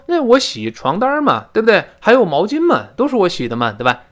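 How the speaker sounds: high, chatty answer from the second speaker; 第二个人高声轻快地回答; lively reply. Acted. (模仿第二个人俏皮回答)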